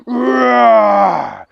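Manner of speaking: loud mock lion roar; long loud roar. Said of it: "And he roared".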